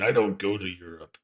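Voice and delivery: lightly voiced shitty wrestler impression; a hint of a wrestling promo voice; Warrior impression. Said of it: "I was gonna do a shitty Warrior impression".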